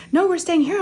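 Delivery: high-pitched play-along reply; retold distracting chatter. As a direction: answer high to keep a child distracted. Mother playing along to distract child.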